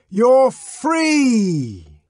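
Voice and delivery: high, loud shout stressing you're. A narrator's reading. Savage showing the empty cash box, shouting. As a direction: proclaim it to the crowd.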